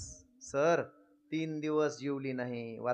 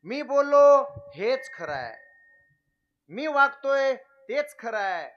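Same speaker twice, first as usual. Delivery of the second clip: mock arrogance; 6 semitones higher, 6 dB louder than usual. Mocking arrogant people.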